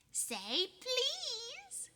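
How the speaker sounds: high teasing drawl; poltergeist voice. Acted. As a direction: bait him playfully. Peeves teasing.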